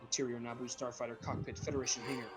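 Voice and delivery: ordinary talk, nothing marked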